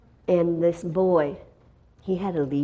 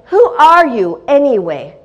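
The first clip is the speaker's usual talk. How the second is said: louder, higher, confronting the spirit; demanding challenge; 6 semitones higher, 11 dB louder than usual. Retold or imitated. Preacher demanding the spirit name itself, loud and challenging. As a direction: challenge the demon head-on. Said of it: "And I said".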